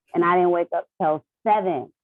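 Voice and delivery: normal talking voice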